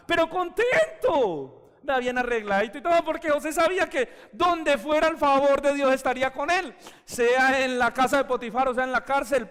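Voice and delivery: high, loud preacher's drive; preaching at full force. Acted. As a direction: lift the church up with it. (predicando con fuerza)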